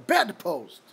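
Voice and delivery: loud odd sneeze word; bedpost sneeze. Retold or imitated. Suggesting another sneeze word to viewers, loud mock sneeze. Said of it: "why you say that and not, like".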